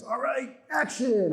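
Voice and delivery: high, drawn-out mock director's call; camera operator's cue. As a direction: call the cue, imitating a film crew. Camera guy calls action.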